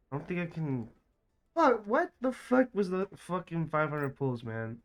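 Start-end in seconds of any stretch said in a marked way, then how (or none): 1.5-4.8 frustrated outburst. Real, not acted. Raging at bad gacha pulls.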